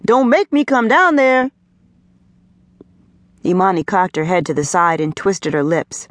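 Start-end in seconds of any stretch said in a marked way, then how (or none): 0.1-1.5 raised-pitch parental warning; calling from upstairs. A narrator's reading. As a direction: warn the child from upstairs, voice pitched up. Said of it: "Maceo called out from upstairs".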